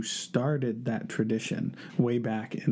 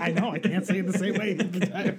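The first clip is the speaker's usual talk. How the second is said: higher, quick words through laughter; flustered laughing; 6 semitones higher, faster than usual. Real, not acted. Co-host teased about his mispronunciation, laughing and flustered. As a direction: own up while cracking up.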